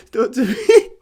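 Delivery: high, loud words through real laughter; real giggling fit; laughing helplessly. Real, not acted. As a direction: squeeze it out while cracking up. Helpless giggles after getting distracted.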